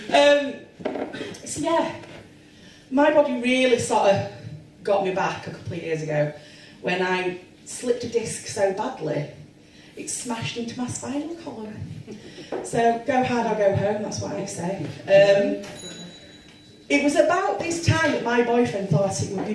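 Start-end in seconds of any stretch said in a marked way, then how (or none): none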